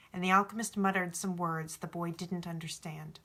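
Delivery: usual speaking voice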